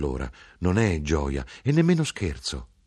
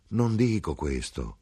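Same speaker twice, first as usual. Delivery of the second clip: slow denial; slower than usual. Acted. Son to mother, slow steady denial. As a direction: calmly hold your ground.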